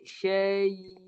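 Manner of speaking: "Syai" drawn out on a level note. Shown as a demonstration. Teacher demonstrates the silent ya to students, drawn-out demo. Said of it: "mati tengah lidah naik".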